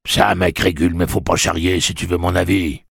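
protest, put out and testy; bothered, pushy objection; annoyed grumble. A narrator's reading. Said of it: "aussi embêté qu'agacé".

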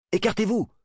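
high, called-out warning; urgent command. Acted. Ender orders ships to scatter.

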